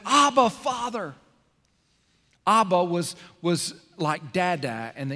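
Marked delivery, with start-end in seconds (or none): 0.0-1.1 higher, slow plea to the father; crying out. Retold or imitated. Jesus crying out to his Father, slow and raised. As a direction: cry it out, drawn out. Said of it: "he cries out and he says".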